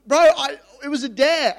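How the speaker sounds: high, loud comic excuse; mock desperate protest. Trapped teen explaining himself to the manager, mock desperate protest. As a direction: plead loudly in hammed-up panic. Retold plea from the cold room.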